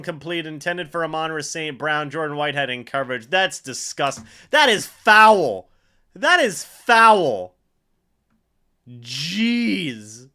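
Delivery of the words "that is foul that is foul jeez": disgusted. Real, not acted. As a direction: spit it out like it stinks. Disgust at a Lions completion.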